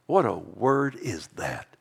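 normal talking voice

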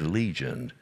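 low, soft demon answer; demon's name. Retold or imitated. Preacher voicing the demon giving its name, low and soft. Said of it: "And he said".

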